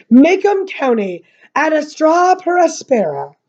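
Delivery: slow, drawn-out ceremonial announcement; grand declaiming. A narrator's reading. Missus Merriweather opens the pageant from her lectern, declaiming.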